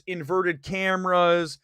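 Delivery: ordinary talk, nothing marked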